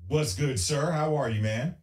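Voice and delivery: ordinary talk, nothing marked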